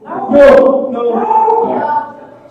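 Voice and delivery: joking loud high "no". Real, not acted. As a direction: yell it up high as a joke. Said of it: "Why do you say it so loud".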